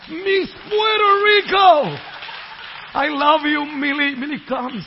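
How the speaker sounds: loud, high, delighted shout; excited call to his wife. Real, not acted. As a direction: hail her across the room.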